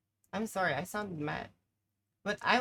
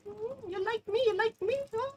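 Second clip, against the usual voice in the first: very high flirty taunt; high teasing; 13 semitones higher than usual. Real, not acted. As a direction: taunt him playfully. Teasing enemy player.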